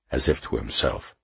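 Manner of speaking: ordinary delivery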